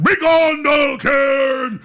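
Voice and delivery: loud, high-pitched drawn-out incantation yell; booming ritual shout. Retold or imitated. Storyteller imitates the voice banishing at the ceremony, drawn-out shout. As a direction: bellow a banishment, stretching the words.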